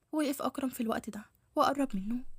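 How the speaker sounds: ordinary delivery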